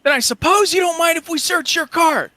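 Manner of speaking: high, loud call across a distance; pointed, challenging holler; shouted demand. Acted. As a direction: press the travelers at full voice. Shouted standoff across the road.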